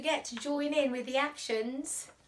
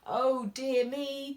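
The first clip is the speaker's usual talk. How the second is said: usual speaking voice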